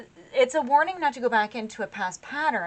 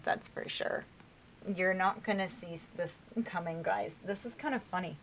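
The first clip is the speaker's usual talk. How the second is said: soft suppressed laughter; 2 semitones lower, 8 dB quieter than usual. Real, not acted. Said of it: "I don't mean to be laughing".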